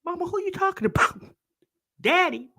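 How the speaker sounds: high, fast, baby-voiced question; toddler voice. Commentator voicing the toddler asking mom who daddy is, high baby voice. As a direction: play the confused little boy. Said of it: "He looking like".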